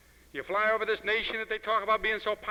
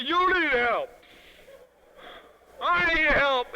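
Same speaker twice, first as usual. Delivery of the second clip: louder, much higher mock plea; mock cry for help; 9 semitones higher, 9 dB louder, much slower than usual. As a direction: call out like someone begging for help. Hippies answer the helpers.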